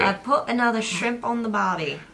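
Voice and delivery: put-on a deliberately terrible Australian accent; bad Australian accent. Wife doing her worst Australian accent. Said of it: "the worst Australian accent I've ever said".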